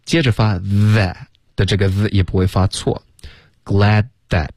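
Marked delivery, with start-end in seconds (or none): 3.6-4.5 "glad that" with a crisp stop in place of the D; glad, tiny stop, that, final T unreleased; dropped D before that. Shown as a demonstration. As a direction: hold glad briefly, then release into that. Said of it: "干脆的停顿".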